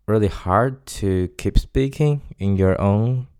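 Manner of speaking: ordinary delivery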